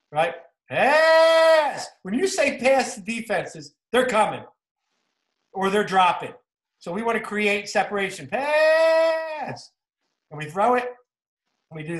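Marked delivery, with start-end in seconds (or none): 0.7-1.9 long, high-pitched coach's shout; yelled out. Shown as a demonstration. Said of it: "we yell out pass". 8.3-9.7 high, held field call; yelled out. Shown as a demonstration. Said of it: "we yell out pass".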